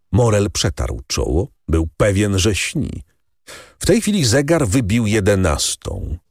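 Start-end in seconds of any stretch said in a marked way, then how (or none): none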